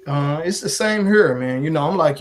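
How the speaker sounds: ordinary talk, nothing marked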